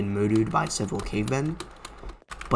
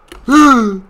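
loud, high gasp; high yelp; startled gasp; 12 semitones higher, 14 dB louder than usual. Real, not acted. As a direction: gasp sharply in fright. Scare in game.

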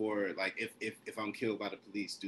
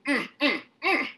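rising, high mm of pleasure; savoring hum; 8 dB louder than usual. Acted. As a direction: hum like tasting something delicious. Savoring food in the poem.

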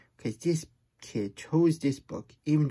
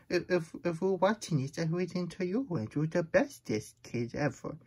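ordinary delivery, nothing marked

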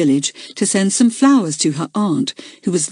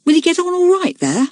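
anxious schoolboy; 11 semitones higher than usual. A narrator's reading. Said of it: "whispered a second former".

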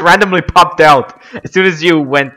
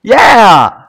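sharp triumphant shout; loud cheer; 4 semitones higher, 4 dB louder than usual. Real, not acted. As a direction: belt it out in triumph. Reacting loudly after fight.

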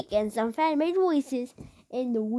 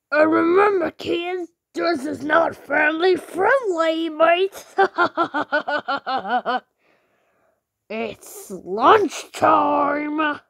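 a higher, louder mock-Australian villain; Australian Arnie; 4 semitones higher, 4 dB louder, much slower than usual. YouTuber voices Arnie with a mock Australian accent. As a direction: sneer it in a hammed-up Australian accent. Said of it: "He'll be a little bit Australian".

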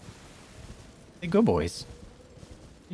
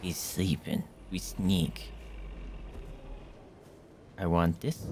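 softer, low hushed voice; sneaky hush; 5 semitones lower, 13 dB quieter, slower than usual. Real, not acted. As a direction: hush it while tiptoeing past. Sneaking past sleeping bear.

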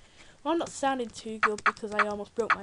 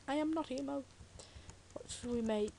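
normal talking voice

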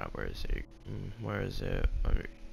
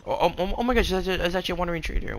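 excited; 8 semitones higher, much faster than usual. Real, not acted. Spots a rare wandering trader.

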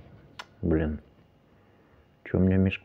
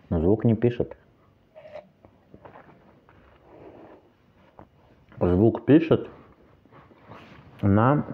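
talking with mouth full; speaking while eating fruit; chewing watermelon; 3 semitones higher, 3 dB quieter, slower than usual. Real, not acted. Seen 1: Fork of watermelon in hand; cheeks full, mouth chewing while talking.

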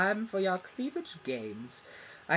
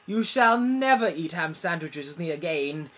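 building to a loud high yell on never, ever, never; hammed-up tantrum, louder each word; huffy friend builds to a hammed-up shout; mock furious; 5 semitones higher, 10 dB louder than usual. Banishing friend from sandwiches.

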